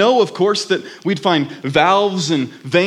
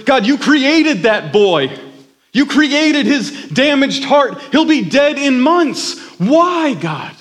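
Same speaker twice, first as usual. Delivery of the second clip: higher and louder, furious grief; angry outcry; 9 semitones higher, 5 dB louder, slower than usual. A narrator's reading. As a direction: rage at God through tears. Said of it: "he cried out in anger".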